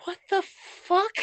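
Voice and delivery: mock high-pitched squeal of disbelief; squeaky, drawn-out retold shock; baffled disbelief. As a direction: stretch it out high and slow, acting stunned. Acting out their baffled reaction.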